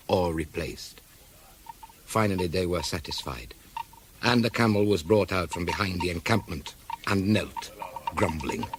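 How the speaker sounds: low, measured storytelling; story narration. A narrator's reading. Policeman recounting bargain for a camel, measured narration. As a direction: recount it evenly, phrase by phrase.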